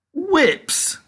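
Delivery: very high shriek of surprise; a spoilt girl aghast; shocked cry. A narrator's reading. Veruca cries out at the sign, very high and shocked. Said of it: "cried Veruca Salt".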